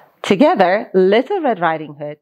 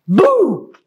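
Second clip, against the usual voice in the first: a loud, higher playful shout; sneaking into the bedroom to scare the sleeping wolf, a loud higher boo; 7 semitones higher, 5 dB louder than usual. A narrator's reading. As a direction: jump out and yell it to scare the wolf. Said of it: "and shouted".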